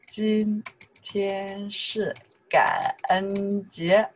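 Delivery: very slow, held syllables; drawn-out demonstration. Shown as a demonstration. Teacher modelling a sentence for learners, very slowly. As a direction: stretch every syllable for learners.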